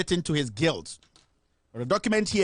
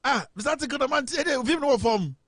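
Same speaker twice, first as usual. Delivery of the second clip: surprised high protest; 9 semitones higher than usual. Real, not acted. Reacting to caller's nickname.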